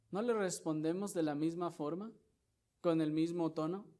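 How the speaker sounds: loud, pressing sermon question; forceful preaching. Acted. Pastor challenging the brethren, loud preaching. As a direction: drive the point home.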